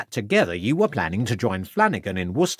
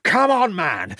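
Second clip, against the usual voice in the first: slow, high, forceful demand building to a shout; demanding detective; 8 semitones higher, slower than usual. Acted. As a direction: slap the table and demand the truth. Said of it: "demanded Ravenscroft".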